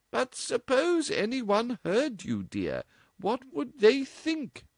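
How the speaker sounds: very high, slow worried mother; genuine concern. A narrator's reading. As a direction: ask anxiously. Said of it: "genuine concern in Missus West's voice".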